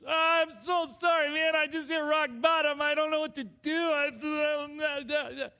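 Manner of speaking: high, loud blurted confession; hammed-up wail of apology, falling apart; panicked apology. Caught by his brother at rock bottom, panicked apology.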